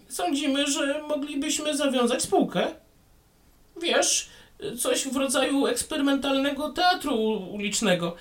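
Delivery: a little gnome making an offer; thin voice. Acted. Gnome proposes street theatre.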